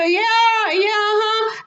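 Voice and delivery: high, sing-out mock call; calling back. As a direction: holler back over your shoulder while fleeing. Calling back while running away.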